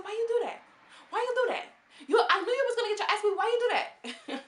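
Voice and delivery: high, loud, incredulous scolding; voicing the boss scolding Barbie. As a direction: shriek at her like a fed-up boss.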